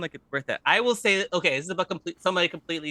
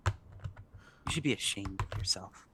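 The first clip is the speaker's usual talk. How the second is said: hushed shaming; 6 semitones lower, 9 dB quieter, slower than usual. Acted. Hushed shaming after louder line.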